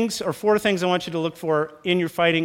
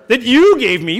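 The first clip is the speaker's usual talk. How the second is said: louder, higher blame-shifting whine; defensive Adam; 7 semitones higher, 10 dB louder than usual. Retold or imitated. Adam blaming God for the woman, louder defensive whine. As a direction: point the finger back at God. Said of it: "blames her".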